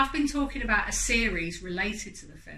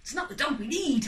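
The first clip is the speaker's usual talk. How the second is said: weak West Country accent attempt; half-hearted West Country accent; 6 semitones higher than usual. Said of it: "In a West Country accent".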